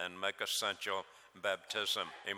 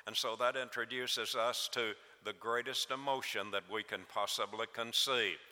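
normal talking voice

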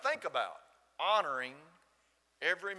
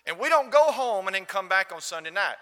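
high, loud, emphatic quote; loud retold insistence; 5 semitones higher, 11 dB louder than usual. Gentleman explaining his family's long Sunday, loud retold insistence. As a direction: imitate him making his case loudly.

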